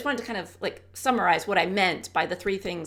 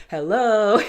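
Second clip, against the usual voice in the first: calling for attention; 3 semitones higher, 9 dB louder than usual. Retold or imitated. Husband calls her back.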